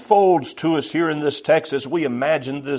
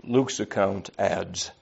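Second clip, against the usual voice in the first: ordinary talk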